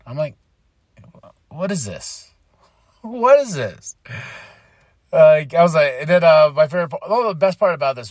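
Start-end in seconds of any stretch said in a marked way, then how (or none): 1.5-2.3 soft, deflated disbelief; quiet bafflement. Retold or imitated. Fan reacting to the backstage scene, quietly baffled. 3.0-4.0 high, exasperated question; exasperated bafflement. Retold or imitated. Fan reacting to the backstage scene, exasperated.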